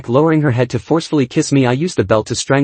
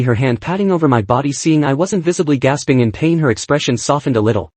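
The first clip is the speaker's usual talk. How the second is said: ordinary delivery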